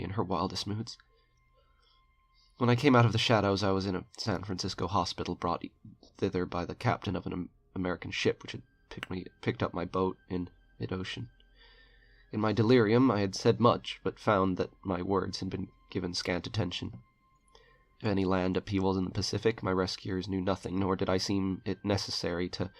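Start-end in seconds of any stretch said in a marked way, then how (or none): none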